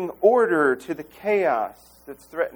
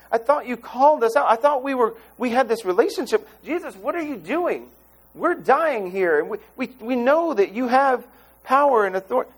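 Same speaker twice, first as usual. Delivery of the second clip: high, pleading accusations; panicked disciples crying out to Jesus; desperate pleading; 9 semitones higher than usual. Retold or imitated. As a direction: beg him, hurt and frantic.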